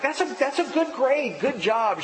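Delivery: re-enacted high-pitched congratulation; upbeat parent's praise, retold; encouraging praise. Acted father explaining and praising grade.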